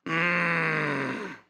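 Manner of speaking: low, drawn-out knowing hum; a slow, sage murmur from an old believer; mock spiritual elder humming; wise old saint. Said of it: "And they go".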